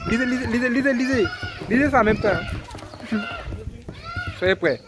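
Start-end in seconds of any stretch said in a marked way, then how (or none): 2.4-4.3 high laugh. Real, not acted. Laughs at pages moving.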